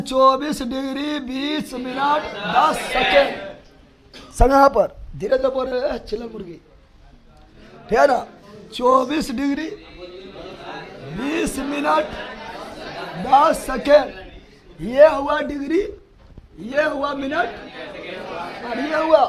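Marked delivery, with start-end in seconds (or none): 0.1-4.3 दस second stretched out very slowly; slow drill. Shown as a demonstration. Teacher to students, drawing the words out slowly. 8.7-14.9 दस second stretched out very slowly; slow drill. Shown as a demonstration. Teacher to students, drawing the words out slowly.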